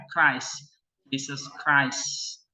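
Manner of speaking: "Christ" with an aspirated K and a long vowel. Shown as a demonstration. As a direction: slowly say "Jesus Christ", hard K. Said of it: "Christ itu ke". (Christ dibaca dengan bunyi K)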